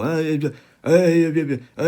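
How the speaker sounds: put-on high, loud drunken babble; slurring alcoholic. Imitating how alcoholics go, slurred drunk noises. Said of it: "where they slur the words".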